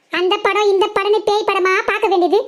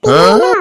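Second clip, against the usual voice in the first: ghost shriek; 14 semitones lower, 6 dB louder, much slower than usual. Acted. Teasing the scared friend with a loud shrieked cry.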